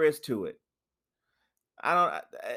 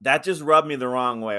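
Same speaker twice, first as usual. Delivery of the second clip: ordinary delivery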